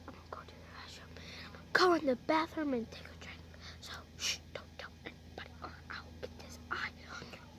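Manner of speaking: hushed whispered aside; very soft, conspiratorial whisper; secretive whisper. Pretend.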